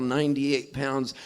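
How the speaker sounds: normal talking voice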